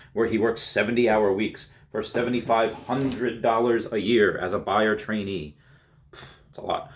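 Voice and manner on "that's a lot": breathy, soft whisper; whispered aside. Real, not acted. Narrator whispering at the long work weeks. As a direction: whisper it to yourself.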